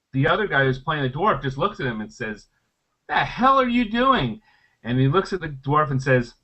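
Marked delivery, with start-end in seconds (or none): 3.1-4.4 high, slow, aghast retelling; incredulous. Retold or imitated. Dwarf player to squirrel killer, incredulous. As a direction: act out stunned disbelief, stretched out.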